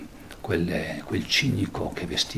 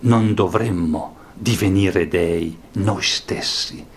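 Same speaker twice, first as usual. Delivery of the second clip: higher, louder declaiming; dramatic reading; 5 semitones higher, 5 dB louder than usual. Acted. Teacher rereading the madman's words to students, declaiming. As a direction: proclaim the death of God.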